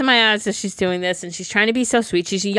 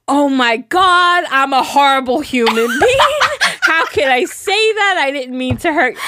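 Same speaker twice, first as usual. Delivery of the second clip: flustered dentist; 5 semitones higher, 4 dB louder than usual. Retold or imitated. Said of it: "that threw him into a".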